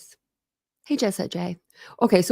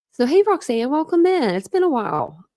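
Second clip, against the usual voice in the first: sudden high greeting; 7 semitones higher, 4 dB louder than usual. Real, not acted. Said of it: "I don't know why I said wow like that".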